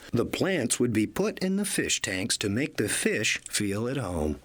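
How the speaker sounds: ordinary delivery, nothing marked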